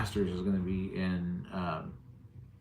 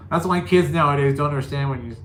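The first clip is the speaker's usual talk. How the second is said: ordinary talk, nothing marked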